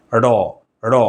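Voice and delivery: র as ড়, a retroflex flap "ṛo"; between d and r. Shown as a demonstration. Teacher demonstrates the dotted d letter, র as retroflex flap. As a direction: curl and flap the tongue on র, halfway to d. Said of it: "ড আর র এর মাঝামাঝি".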